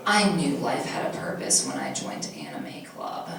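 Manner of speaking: very low, hushed emo murmur; whispery emo confession; emo scene kid. Acted. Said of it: "then, like, she goes".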